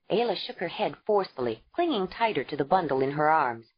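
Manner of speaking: usual speaking voice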